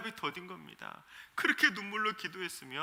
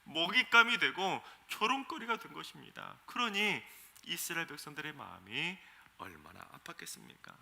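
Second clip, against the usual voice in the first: ordinary talk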